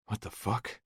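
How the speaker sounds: mutter. A narrator's reading. Hurt boy waking in the road, muttering. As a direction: mutter it to yourself, dazed. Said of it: "I mutter".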